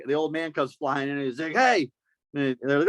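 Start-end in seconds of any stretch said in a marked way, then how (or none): 1.5-1.9 put-on higher angry old man; old man yelling. Old man rushing at the kids, mock yelling. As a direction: bark it.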